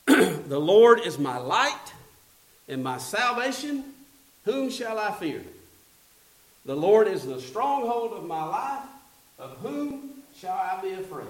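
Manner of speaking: slow, loud, proclaimed psalm; stately proclamation of the verse; scripture reading. Acted. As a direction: read it out like from the pulpit. Reads the psalm aloud.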